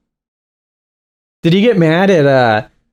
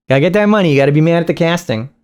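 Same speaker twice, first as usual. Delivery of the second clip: ordinary talk, nothing marked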